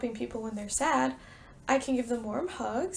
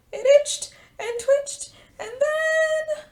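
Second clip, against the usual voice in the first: higher and louder up to a big "achoo!"; building sneeze; 13 semitones higher, 9 dB louder, slower than usual. A narrator's reading. Teacher reading the sneeze to preschoolers, building. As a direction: wind up the sneeze and let it burst.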